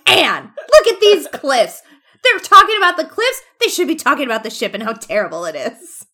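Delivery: loud, high mock rant; hammed-up indignant outburst; fanboying. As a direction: act out a fanboy getting carried away. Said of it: "he's very clearly fanboying over this".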